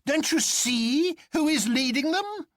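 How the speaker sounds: high jolly doctor teasing his friend; Bull crying out. A narrator's reading. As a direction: drag out the insult, high and amused. Bull's voice held in narration.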